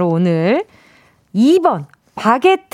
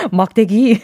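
mock French reading; 3 semitones lower, slower than usual. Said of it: "왜 이렇게 읽었을까?".

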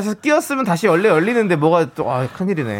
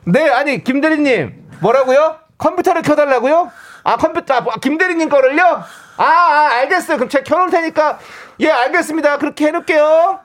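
high, carrying phone voice; obliviously loud phone replies; loud coworker voice; 7 semitones higher than usual. Acted. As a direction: repeat everything so the whole office hears. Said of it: "진짜 큰 소리로".

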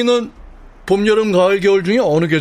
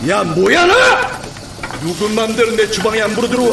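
harsh barking commands; 6 semitones higher than usual. Acted. Chef throwing out applicant.

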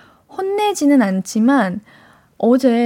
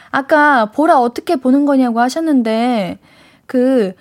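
ordinary talk, nothing marked

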